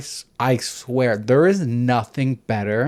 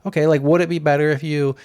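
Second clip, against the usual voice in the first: ordinary talk, nothing marked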